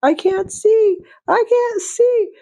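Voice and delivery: put-on very high, thin child's whine; imitating the Polar Express boy; creepy movie child. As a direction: whimper like the spooky kid in the film. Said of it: "that creepy Tom Hanks child is like".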